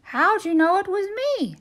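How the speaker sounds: high, fast and baffled; surprised turkey voice. Acted. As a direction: blurt it out.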